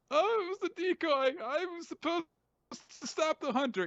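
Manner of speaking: very high mock-pleading voice; scolded lackey making excuses; whiny underling. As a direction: protest in a squeaky, hammed-up whine.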